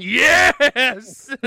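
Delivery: sudden loud high "Yes!"; real burst of delighted shouting; excited shout. Real, not acted. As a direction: yell it out in delight. Hears the boss's cruel comeback.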